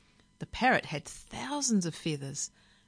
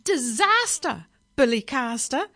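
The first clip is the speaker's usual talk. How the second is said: parrot screech; 8 semitones higher, 8 dB louder than usual. A narrator's reading. Parrot on the floor after crash, screeching. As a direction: screech it in outrage. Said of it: "it screeched".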